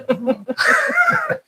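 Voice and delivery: real laugh, high and trailing down; amused high-pitched laugh. Real, not acted. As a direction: burst into a laugh that falls away. Laughs after admitting joke.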